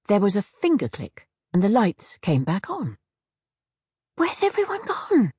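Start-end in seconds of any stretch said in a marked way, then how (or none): 4.2-5.3 high, alarmed cry. A narrator's reading. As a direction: call out in panic. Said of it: "Lena cried".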